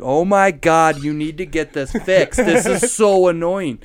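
loud, exasperated complaint; annoyed outburst. Retold or imitated. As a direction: blurt it out, fed up. Blurting at parents' car.

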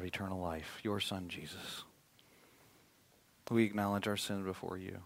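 soft, low, reverent prayer; quiet prayer. Acted. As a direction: lead the church in a hushed prayer. Closing prayer.

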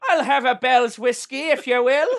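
high, hammed-up Irish accent; ordering a drink as a stage Irishman; laughing mock Irish lilt. Shown as a demonstration. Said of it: "my Irish is passable".

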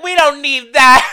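high, loud, slow laughing dismissal; hamming up their carefree attitude; mock dismissive. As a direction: brush it off with a laugh. Mocking their past selves.

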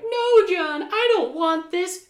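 high, loud mock scream of panic; retold scream. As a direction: shriek the refusal like a terrified woman in a movie. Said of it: "She's screaming like".

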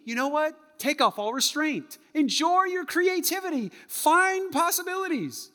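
very high, bright mock cheer; high, loud mock enthusiasm; literal, enthusiastic. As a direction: sell it like a motivational poster. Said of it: "Do you mean".

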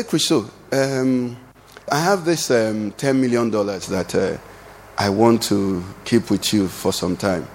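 low, slow and halting, with ums; tentative pretend pitch to a friend; hesitant request. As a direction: feel your way carefully into an awkward favour. Halting, awkward money request to friend.

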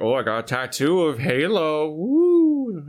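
hammy showman, high whoop at the end; mocking hype announcer. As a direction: sell the dumb gimmick. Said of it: "It's like".

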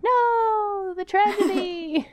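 high, loud melodramatic wail; mock despair. Viewer lamenting a broken biscuit, melodramatic mock despair. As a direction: stretch it into a hammed-up lament. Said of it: "accidentally break it in half, and I'm like".

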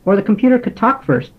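usual speaking voice

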